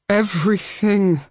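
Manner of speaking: a heavy, weighted single word; heavily. Acted. Abel admitting guilt to Hal, heavily. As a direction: let it fall like a confession. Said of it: "Abel said heavily".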